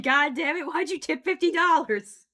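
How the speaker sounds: loud exasperated yell; mock outrage. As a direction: shout it in fake frustration. Tip jar spills, mock outburst.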